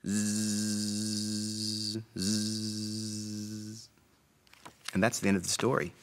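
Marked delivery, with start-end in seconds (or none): 0.1-3.9 long droning sleep buzz; pretend robot snooze. A narrator's reading. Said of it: "go to sleep".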